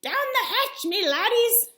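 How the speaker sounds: high, loud, slow pirate bellow; pirate yell. Acted. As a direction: roar it like a rowdy pirate toast.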